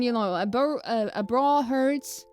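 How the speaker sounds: usual speaking voice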